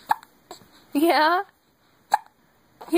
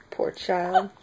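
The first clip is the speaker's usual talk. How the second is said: ordinary talk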